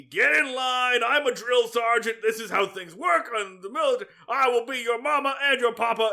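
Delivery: mock drill-sergeant yell; high, loud boot-camp bellow, hammed up; Sergeant Carter. Sergeant yelling at new recruits, mock military shout. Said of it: "Sergeant Carter's yelling at everybody".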